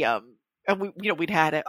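normal talking voice

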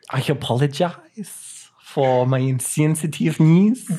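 slow, badly faked Russian accent; mock Russian accent. Apologizing to listeners for the botched accent, slow mock Russian accent. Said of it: "I'll apologize in a Russian accent".

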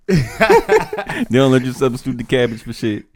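laughing through the words, slowly. Real, not acted. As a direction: chuckle while griping. Laughs while complaining.

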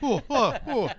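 high, loud comic ohs; hammed-up bursts of excitement; Robin Williams. Showing off a comedian impression, high and loud. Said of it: "That's my Robin imper-, Robin Williams".

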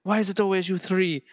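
put-on prim Edinburgh accent; refined Scottish professor's question; Professor McGonagall. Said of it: "more like Professor McGonagall".

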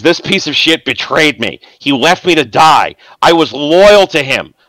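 rising resentment at being left to die; bitter betrayal. Acted. GM voices bitter betrayed NPC.

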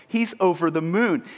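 ordinary talk, nothing marked

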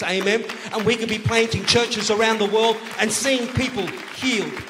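rally the congregation to the Great Commission; full-force preaching. Acted. Pastor urging church to plant churches worldwide, full-force preaching.

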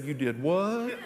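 imitating the wife's incredulous laugh; a laughing, disbelieving question; wife hearing he threw his keys, laughing in disbelief. Wife reacts to thrown keys.

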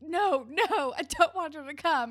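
high, loud mock protest; emphatic refusal. Boyfriend doesn't want her to come, mock protest. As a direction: act out a pleading no. Spinner motioning a frantic refusal.

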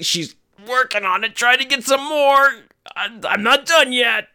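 high, effortful mock strain; hammed-up strained kid voice; imitated straining. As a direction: squeeze the words out as if pushing. Daughter straining to get more out.

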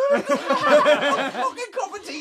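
high, loud outraged outburst through laughter; incredulous shout. Retold or imitated. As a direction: shriek it in comic disbelief. Teller's outraged reaction to tea request.

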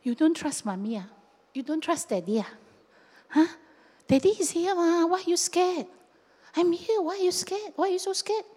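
put-on parent to child. Father or mother to a scared child, coaxing reassurance. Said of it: "Like a father or a mother would look at the child".

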